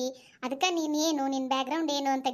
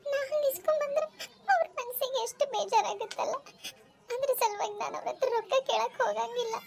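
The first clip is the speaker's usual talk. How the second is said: high-pitched, sobbing lament; tearful crying; 7 semitones higher than usual. Acted. Poor student confiding in friends about her parents, crying. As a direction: pour it out through tears, voice pitched high. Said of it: "ಮೊದಲು ಕಣ್ಣೀರ್ ಒರೆಸ್ಕೊ".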